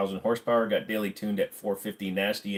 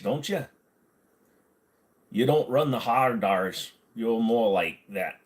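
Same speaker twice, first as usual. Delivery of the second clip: ordinary talk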